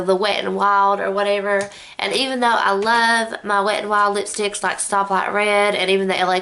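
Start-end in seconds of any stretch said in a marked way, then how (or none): none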